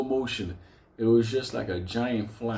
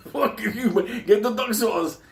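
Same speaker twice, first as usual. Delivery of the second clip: mock yell; 12 semitones higher, 5 dB louder than usual. Spanky yelling at delivery guy.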